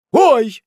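high, loud "oy" of pain; sudden high cry; startled yelp. Acted. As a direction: yelp as if pinched. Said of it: "закричал мистер Пиквик".

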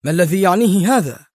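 high, cutting question; fierce demanding tone; sharp angry demand. A narrator's reading. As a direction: snap at Qadri. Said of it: "هتف الطبيب في حدة".

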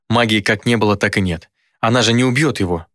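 ordinary delivery, nothing marked

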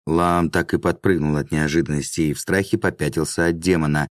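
usual speaking voice

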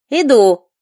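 loud irritated yell; angry shout. A narrator's reading. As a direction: bark it through the door. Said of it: "раздался гневный женский голос".

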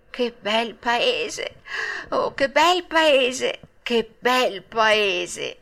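very slow, dreamy exclamations; rapt admiration. A narrator's reading. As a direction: stretch each repeat in blissful wonder. Dreaming of a land without school.

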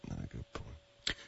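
soft whisper. Real, not acted. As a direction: whisper it aside. Joking retraction.

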